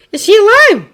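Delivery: high, loud anxious question; calling out. A narrator's reading. Mount Lady asks about the found hero, calling out. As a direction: shout the question across the rooms. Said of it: "calling to each other from within".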